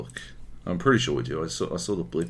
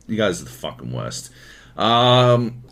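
ordinary delivery

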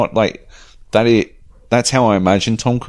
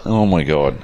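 normal talking voice

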